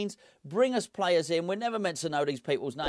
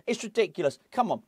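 usual speaking voice

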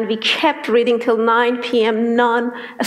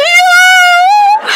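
much higher, loud joyous shout; shouted yeah; 9 dB louder than usual. Retold or imitated. Her friend agreeing, a loud high joyful shout. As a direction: yell it in delight.

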